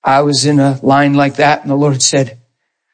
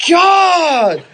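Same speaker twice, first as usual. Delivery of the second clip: startled cry; 14 semitones higher than usual. Retold or imitated. Seeing the man on the floor.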